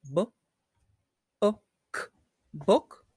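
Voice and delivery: "book" broken into b, short oo, k; phonics-style "book" with short vowel; sounded out. Shown as a demonstration. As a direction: sound out "B-ook", then blend "book" rising.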